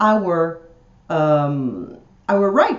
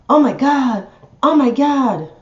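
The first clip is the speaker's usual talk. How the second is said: higher, louder mock shock; mock emotional outcry; 5 semitones higher, 5 dB louder than usual. Mocking viewers who swallow the news, louder mock-emotional gasping. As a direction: overreact like an emotional sheep, pitched up. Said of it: "just a bunch of emotional machines".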